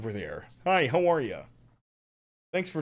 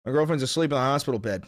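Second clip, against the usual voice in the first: ordinary delivery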